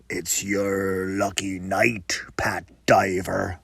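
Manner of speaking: slow, low whisper of a hulking brute; huge man whispering a taunt to Pat; menacing whisper. Acted. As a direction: breathe the threat softly.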